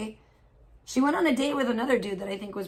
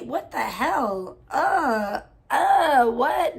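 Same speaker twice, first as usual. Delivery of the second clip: appalled disbelief; 3 semitones higher, 4 dB louder, much slower than usual. Real, not acted. Disgusted shock at story.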